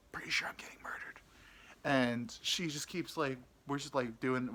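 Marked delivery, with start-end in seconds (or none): 0.1-1.2 fast, hushed aside; acted worried whisper; nervous whisper. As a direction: whisper it like a secret worry. Private fear in the car.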